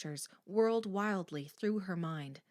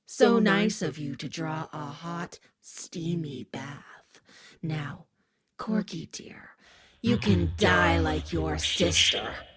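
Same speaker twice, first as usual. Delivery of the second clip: creepy killer whisper; 5 dB louder, slower than usual. Acted. Killer taunting victim at tub.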